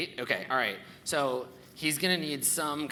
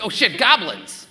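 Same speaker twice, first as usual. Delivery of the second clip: startled tall man; 9 semitones higher, 14 dB louder than usual. Acted. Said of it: "he's like".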